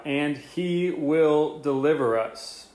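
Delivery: "will" leaned on, the whole phrase drawn out; slow it down so the promise lands; slow emphasis on will. Shown as a demonstration. Preacher stressing the promise to his listeners, slow. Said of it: "and check it out".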